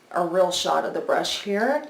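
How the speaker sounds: ordinary talk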